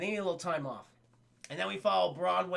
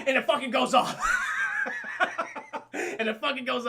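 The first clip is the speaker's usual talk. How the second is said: laughing through the words; can't stop laughing telling it; 5 semitones higher, 4 dB louder than usual. Real, not acted. Telling chat the gun went off, laughing through it.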